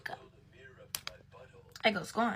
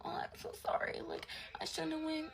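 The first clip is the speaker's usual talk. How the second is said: mock crying; 8 semitones higher, 5 dB quieter than usual. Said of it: "She crying in my arms".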